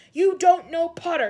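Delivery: loud, high furious shriek; frenzied shriek. A narrator's reading. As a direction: shriek it out of control. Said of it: "shrieked Snape".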